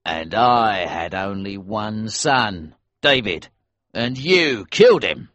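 bitter accusation. A narrator's reading. Bereaved father accuses son's killer.

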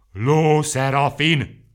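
very slow, high, loud roar; three voices roaring the name together; bellowed in chorus. A narrator's reading. The three friends bellowing the name in chorus, slow roar. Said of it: "bömbölték kórusban".

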